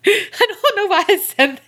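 high, helpless laughter through the words; laughing regret. Real, not acted. Admitting it was a terrible thing to say, laughing. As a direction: try to talk while cracking up.

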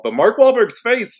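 ordinary talk

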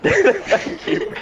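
high, breathy laughing thanks. Acted. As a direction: giggle through a squeaky thanks.

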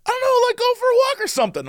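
high, fast, careless doctor voice; mock the doctors' shrugging advice in a squeaky voice. Mimicking clueless doctors, high and fast. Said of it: "And they're like".

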